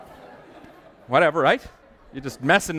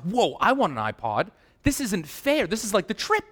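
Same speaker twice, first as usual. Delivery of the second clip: high-pitched mock indignation, climbing as it goes; excited protest; 8 semitones higher than usual. Customer spots the free iPod banner, excited protest. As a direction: act out an eager customer's outcry.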